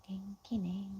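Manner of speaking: whispered example phrase; soft unvoiced practice line. Shown as a demonstration. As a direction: breathe it out in a whisper.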